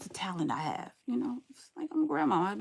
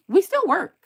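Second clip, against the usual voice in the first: loud retort; 11 semitones higher, 10 dB louder than usual. Real, not acted. Repeating a retort to a viewer.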